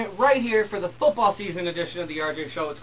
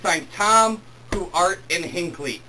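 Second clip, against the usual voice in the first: normal talking voice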